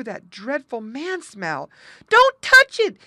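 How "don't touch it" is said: much higher, loud frightened cry; a scared muskrat begging his friend to stop; panted warning. Acted. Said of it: "he panted".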